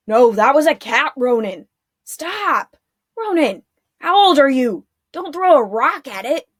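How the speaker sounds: loud, exasperated soldier scolding; one guard yelling at his dim partner; gruff arguing guard. A narrator's reading. Guards quarrel.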